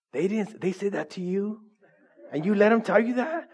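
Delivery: high-pitched mock disbelief with a laugh; egging her on. Coworker goading the negative nurse for fun, mock shocked. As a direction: play scandalized to wind someone up. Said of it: "like, egg her on".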